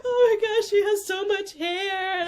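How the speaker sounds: put-on new mother marveling at her baby; tearful delight. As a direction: gush over the baby's hair, half crying. First sight of the baby.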